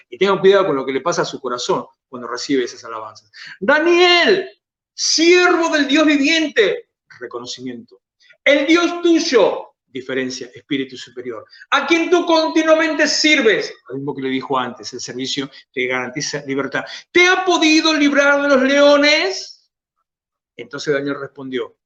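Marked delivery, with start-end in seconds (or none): 3.6-6.9 high, slow, pleading call of a king; anguished king. Acted. King calls into the lions' pit. 8.4-9.7 high, slow, pleading call of a king; anguished king. Acted. King calls into the lions' pit. 11.7-13.7 high, slow, pleading call of a king; anguished king. Acted. King calls into the lions' pit. 17.1-19.7 high, slow, pleading call of a king; anguished king. Acted. King calls into the lions' pit.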